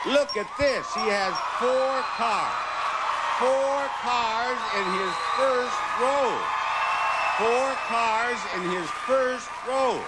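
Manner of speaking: high excited exclaiming. Real, not acted. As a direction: exclaim over the lucky roll. Host excited by big roll.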